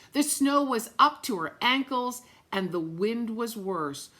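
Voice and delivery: plain reading voice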